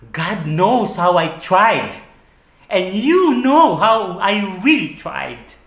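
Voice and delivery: loud, slow, high-pitched plea; building, drawn-out insistence in hurt anger; anguished protest. Acted. Telling partner how hard they tried, in anguished protest. Protesting effort made in relationship.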